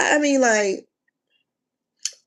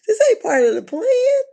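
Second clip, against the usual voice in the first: much higher, whimpering confused voice; 14 semitones higher, 4 dB louder than usual. Retold or imitated. [name] lost in the fight with [name], high and bewildered. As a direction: squeak it out, bewildered. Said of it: "Drew just looked lost, like".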